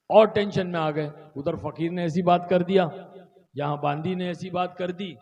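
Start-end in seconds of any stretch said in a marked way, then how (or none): none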